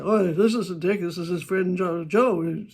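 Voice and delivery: a hint of a stranger's smug reveal; stranger on the phone. Stranger revealing he isn't Dick, lightly voiced. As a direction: play the caller who isn't Dick.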